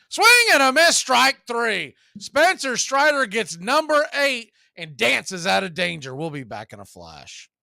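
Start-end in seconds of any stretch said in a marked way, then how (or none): 0.1-6.1 high, loud rising call of the strikeout; excited strikeout call. Real, not acted. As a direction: sell the big strikeout to the radio audience. Strikeout call in live broadcast.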